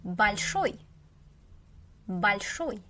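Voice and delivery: "Большой" as bal-SHOY, second syllable stressed; weak A in "Большой", lean on SHOY. Shown as a demonstration. Teacher modelling the adjective, "Большой" said bal-SHOY. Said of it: "If you read it like".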